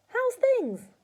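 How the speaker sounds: put-on high, loud boyish hail; cheery call. A narrator's reading. George checking on shrinking Grandma, cheery call.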